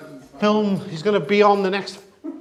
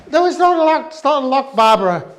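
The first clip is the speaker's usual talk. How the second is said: nun voice; 6 semitones higher, 5 dB louder than usual. Retold or imitated. Acting out the confused nuns.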